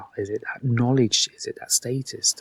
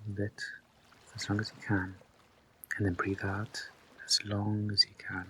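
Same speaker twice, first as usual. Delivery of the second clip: much softer, near-whispered instructions; hushed breathing guidance; 11 dB quieter than usual. Real, not acted. Guide counting listeners through held breaths, hushed and unhurried. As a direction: talk them softly through each breath, leaving long pauses.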